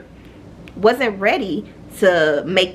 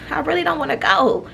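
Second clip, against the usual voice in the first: whiny reluctance; 6 semitones higher than usual. Retold or imitated. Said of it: "I'm just like".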